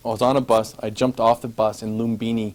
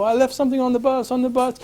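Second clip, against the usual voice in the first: urgent yell; 14 semitones higher, 4 dB louder than usual. Retold or imitated. Shouting to taxi driver.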